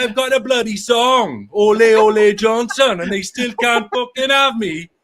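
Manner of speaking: put-on Welsh accent. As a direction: Johnson ranting in a Welsh accent. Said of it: "Bravo on the accent".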